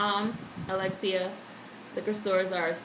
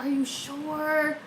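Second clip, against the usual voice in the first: imitating older cashier; 6 semitones higher, much slower than usual. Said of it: "She used to be like".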